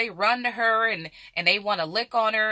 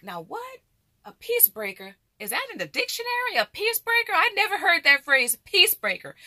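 mock disbelief; 8 semitones higher than usual. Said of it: "I'm like".

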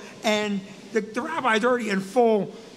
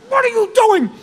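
high, loud, scandalized question; indignant outburst; scolding demand; 9 semitones higher, 6 dB louder than usual. Retold or imitated. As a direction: demand it as if catching someone misbehaving. Vicky scolds during the funeral.

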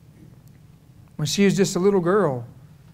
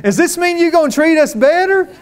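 mock sibling voice; 8 semitones higher, 3 dB louder than usual. Siblings quoted asking Lexi.

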